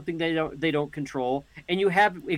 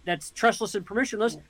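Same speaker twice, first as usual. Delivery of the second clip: ordinary talk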